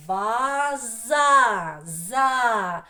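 ваза in long, loud separate syllables, then за. Shown as a demonstration. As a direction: stretch each syllable loudly for a class. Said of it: "перший склад ва". (ваза по складах, голосно й протяжно)

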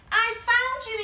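high, loud and thrilled; excited cry. A narrator's reading. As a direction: cry out the joyful discovery. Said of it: "he cried".

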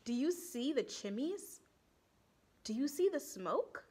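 ordinary delivery, nothing marked

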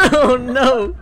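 high laughing oh no; laughing panic. Real, not acted. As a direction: laugh through the alarm. Caught by monster, laughing.

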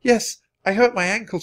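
put-on high-pitched girl's answer; Annie's voice. A narrator's reading. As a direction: pitch it well up for a child reporting an injury.

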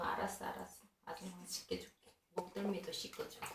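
whispered soothing; whispering. Real, not acted. 속삭이며 달램. (속삭이며 달래기)

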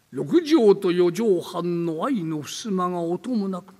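slow, hushed eerie telling; slow creepy storyteller voice; spooky ghost story narration. Acted. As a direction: draw out the dread of a rainy night. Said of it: "音もなくやれ".

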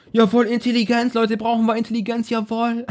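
high-pitched silly mock jubilation; mock cheering. Gamer mock-cheers a useless Intelligenz item, high and silly. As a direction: celebrate in a high put-on voice.

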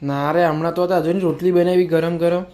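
high, coaxing searching voice; playful mock search. Playing hide and seek with a little child, high and coaxing. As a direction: pretend to hunt for auntie to thrill the child.

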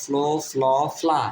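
one vowel each in ফ্লো, ফ্ল, ফ্লা; three sounds of O. Shown as a demonstration. Teacher drilling F-L-O for learners, three sounds of O. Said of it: "এটা উচ্চারণ হবে".

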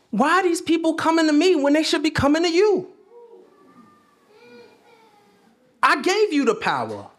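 frustrated Jesus. Acted. Jesus scolding his disciples, building exasperated cry. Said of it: "Jesus looking down ... like".

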